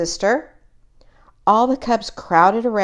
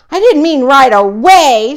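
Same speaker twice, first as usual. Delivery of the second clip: much louder, higher upset protest; stamping tantrum; 7 semitones higher, 11 dB louder than usual. A narrator's reading. Brother protesting to Fred, stamping his feet. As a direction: whine it out like a stamping cub. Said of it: "stamping his feet".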